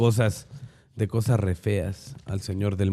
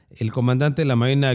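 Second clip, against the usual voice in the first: usual speaking voice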